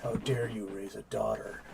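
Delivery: low, slow, soft menace; mom's quiet threat, low and slow; menacing mom. Retold or imitated. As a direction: lean in and growl it quietly. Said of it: "it's like".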